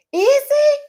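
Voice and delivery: very high, loud squeak of disbelief; shocked. Real, not acted. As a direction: shriek the question in surprise. Shocked at news.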